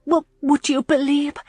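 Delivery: stammering, high and flustered; lightly voiced nervous young lady. A narrator's reading. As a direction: fumble for an excuse. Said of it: "she said nervously".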